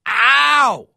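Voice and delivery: loud, high yelp of pain; sharp yelp. Retold or imitated. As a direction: cry out ow as the arm bends back. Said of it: "I'm going".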